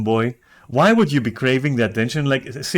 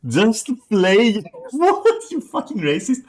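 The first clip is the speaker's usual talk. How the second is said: laughing outrage; 9 semitones higher, slower than usual. Real, not acted.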